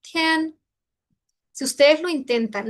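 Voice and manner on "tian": "Tian" said high with the A turning into an E, like tien; pron demo. Shown as a demonstration. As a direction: say "Tian" in first tone, vowel closer to E. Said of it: "Vea este ejemplo".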